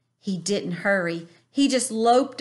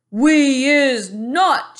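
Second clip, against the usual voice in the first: loud, slow giant chorus shout; 6 semitones higher, 10 dB louder, much slower than usual. A narrator's reading. Giant gang shouting agreement, loud and slow. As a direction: roar it together like a mob. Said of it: "shouted the other giants".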